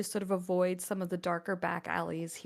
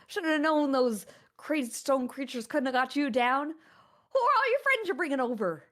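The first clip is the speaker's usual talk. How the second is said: booming, higher, louder hillbilly friend; hearty big farmhand; 5 semitones higher, 5 dB louder than usual. Acted. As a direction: slap them on the back and boom it out. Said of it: "and says".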